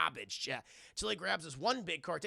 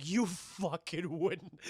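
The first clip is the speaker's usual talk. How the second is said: slow dare; much slower than usual. Retold or imitated. Threat dare.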